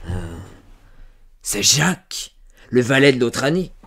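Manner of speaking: loud, rising triumphant accusation; sudden exclamation. A narrator's reading. Farmer guessing it is Jacques, exclaiming. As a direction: burst out as if it just dawned on you. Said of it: "l'homme s'écria".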